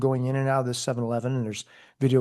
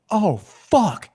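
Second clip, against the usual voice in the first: higher, louder mock yell of alarm; startled yell; 7 semitones higher, 5 dB louder than usual. Partner spots a bee in the car, startled yell. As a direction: yelp it out, retold. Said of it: "he sees it and yells".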